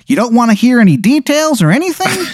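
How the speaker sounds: put-on very high, goofy Chicago accent; badly faked Chicago accent, high-pitched; terrible Chicago accent. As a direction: ask it as a wizard faking Chicago badly, voice way up. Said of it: "a terrible accent".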